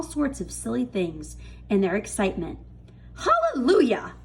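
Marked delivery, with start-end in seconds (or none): 3.1-4.1 high, loud gleeful cry; joyful shout. A narrator's reading. Aunt Spiker rejoicing over the huge peach, joyful shout. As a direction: whoop it like a greedy victory. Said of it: "Aunt Spiker shouted".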